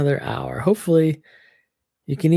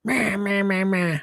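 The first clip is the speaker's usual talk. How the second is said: mocking meh; 4 semitones higher than usual. Mocking customer complaints.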